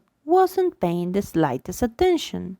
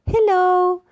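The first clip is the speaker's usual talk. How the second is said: put-on high, sing-song hello to a baby; baby talk hello; 10 semitones higher, 4 dB louder than usual. Shown as a demonstration. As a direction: greet a baby in a high coo. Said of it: "We talk to it".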